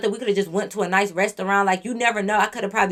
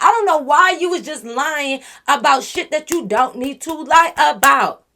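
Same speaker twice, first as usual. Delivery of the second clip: louder and higher, slowing down as she fumes; exasperated rant; 6 semitones higher, 6 dB louder, slower than usual. Real, not acted. Vlogger venting at the liar she met, fed up and loud. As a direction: drag each word out in disbelief. Ranting at lying date.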